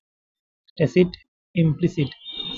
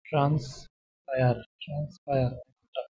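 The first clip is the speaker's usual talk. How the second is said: ট্রান্সপায়ার said twice, much softer and slowly drawn out; soft slow repetition; 9 dB quieter, slower than usual. Shown as a demonstration. Teacher repeating a new word for students to remember, soft and slow.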